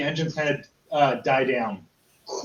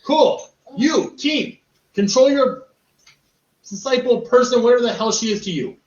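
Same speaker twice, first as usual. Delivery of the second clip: goblin exasperation; 7 semitones higher, 5 dB louder than usual. Acted. Goblin annoyed at party.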